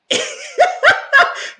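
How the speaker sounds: very high, loud burst of laughter; shrieking laugh. Real, not acted. Laughing at Romeo's baby face, high loud shriek of laughter. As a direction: cackle out loud.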